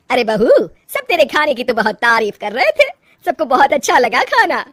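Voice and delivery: loud, pleased mother-in-law. Acted. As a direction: praise the daughter-in-law loudly, with lilting swoops.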